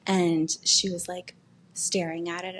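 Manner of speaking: ordinary delivery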